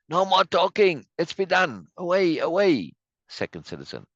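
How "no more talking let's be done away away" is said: high, raised mock mob cry; acted rallying shout, pitched high; crowd urging action. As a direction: call everyone away in a theatrical voice. Reads crowd's cry to set off.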